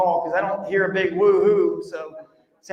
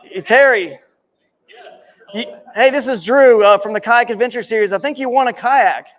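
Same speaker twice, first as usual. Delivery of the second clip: calling out loud; 7 dB louder than usual. Real, not acted. Calling winner over speakerphone.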